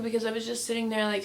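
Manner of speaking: ordinary delivery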